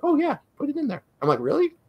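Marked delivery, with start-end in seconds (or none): 0.0-1.0 high, breezy mock agreement; mock owner voice. Owners agreeing, retold by salesman. 1.4-1.8 high, rising mock disbelief; mock surprise. His surprise at their yes.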